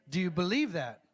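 ordinary talk

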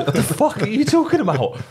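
barely able to get it out for laughing; laughing disbelief. Retold or imitated. Teammates staring at bandaged Churns, high loud laughing question.

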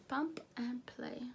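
breathy hushed whisper, slowed down. Real, not acted. Reading the product label to viewers, in a slow whisper. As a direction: whisper the name slowly like a secret. Reads product name softly.

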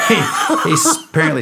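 talking through real laughter; high, breaking into laughs at the start; laughing. Real, not acted. Storyteller amused by his own Fester insult, laughing.

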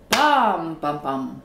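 "PAM, pam, pam", first beat long and loud; stress on first beat. Shown as a demonstration. Teacher showing three-syllable rhythm, "PAM, pam, pam" stressed first. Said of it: "se crée un rythme différent".

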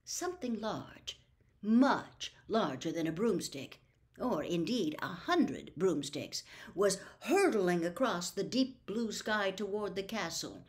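plain reading voice

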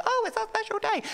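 very high, sing-song mock bride; mock gushing. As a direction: gush it in a squeaky falsetto. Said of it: "it's sort of like".

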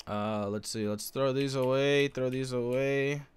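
usual speaking voice

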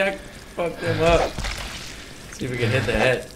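usual speaking voice

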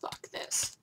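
breathy under-the-breath curse; whispered curse. Real, not acted. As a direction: hiss it quietly to yourself. Muttered curse, then repeated.

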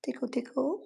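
put-on high soft baby talk. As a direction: coo it to a pet. Talking to bird baby talk.

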